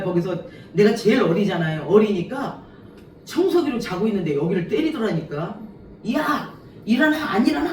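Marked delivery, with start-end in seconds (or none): 6.1-7.7 high, loud barking wake-up yell; angry shout to wake the youngest; retold yell. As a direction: yell at a sleeper to get up. Older resident yells her awake.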